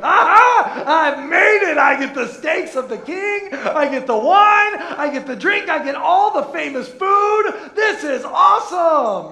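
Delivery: hammed-up triumphant shout; mock gloating glee. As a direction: crow over the king's feast like you've won. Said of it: "some of you would be like".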